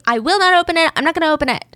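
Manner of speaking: hammed-up, high kid's protest; mock child's scream. A narrator's reading. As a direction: imitate a screaming kid without full volume. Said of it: "he starts scream crying and running and screaming".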